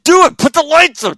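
loud, high shouted orders; excited yell. Retold or imitated. Dad orders sons up the ladder, loud high shout. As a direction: bark it up at the roof.

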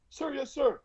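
high, crisp recruit reply; mock recruit answer. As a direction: snap it back like a private. Recruit answering drill instructor.

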